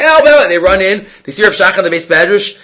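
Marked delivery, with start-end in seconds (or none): 0.0-0.4 high, loud call of help; mock cry for help. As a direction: shout help in alarm. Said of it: "they said".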